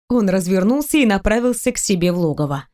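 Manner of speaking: ordinary delivery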